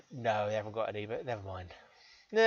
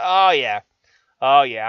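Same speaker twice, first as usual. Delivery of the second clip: loud satisfied oh yeah; 13 dB louder, slower than usual. Real, not acted. Satisfied after success.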